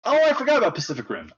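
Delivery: high, loud sudden realization; suddenly remembering something; surprised. Real, not acted. Sudden recollection mid conversation.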